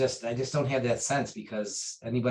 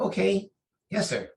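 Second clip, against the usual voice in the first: startled agreement; 10 semitones higher, 5 dB louder than usual. Retold or imitated. Startled agreement to name choice.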